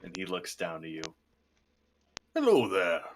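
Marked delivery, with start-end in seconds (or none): 2.3-3.0 a burly dwarf's greeting, a bit louder; intimidating dwarf. Acted. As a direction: greet them like a buff, intimidating dwarf shopkeeper. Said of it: "buff, intimidating dwarven man".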